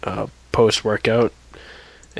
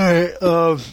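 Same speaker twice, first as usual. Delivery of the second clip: yawning; 9 semitones higher, 4 dB louder than usual. Real, not acted. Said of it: "You just yawn".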